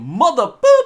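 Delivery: loud mock swearing with a high beep for the curse; big punchy movie-hero cussing; Mace Windu. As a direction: shout it, self-censoring with a squeaked boop. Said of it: "where Mace Windu is, like, saying".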